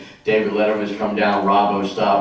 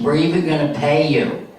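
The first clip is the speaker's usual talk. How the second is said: slightly raised club-owner impression; Mitzi; 4 semitones higher than usual. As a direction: lightly imitate the club boss. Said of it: "And she talks like this".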